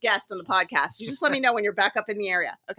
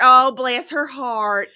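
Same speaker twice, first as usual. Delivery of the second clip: slow, drawn-out Southern bless her heart; 4 semitones higher, 7 dB louder, much slower than usual. Acted. Host recalling her mama, slow Southern sigh.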